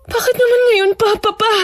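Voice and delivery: high, tearful plea; tearful distress. Acted. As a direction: plead on the edge of crying. Distressed over father's sudden illness. (naiiyak na pagdaing)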